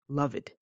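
loved whispered as love-id; whispered wrong ending. Shown as a demonstration. Teacher whispers the error to students. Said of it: "but that's not correct".

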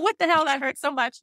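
high pained what the hell; startled outburst. Real, not acted. Mother reacting to painful epidural, high startled outburst.

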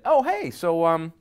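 high, bright feigned casual opener; playing a chirpy guest at a party; mock party opener. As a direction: act the friendly partygoer. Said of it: "push them a little bit".

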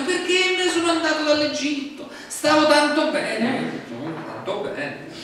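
pine theatrically for the past; nostalgic whining. Retold or imitated. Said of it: "Comincio a piangermi addosso". (nostalgia lamentosa, acuta e lenta)

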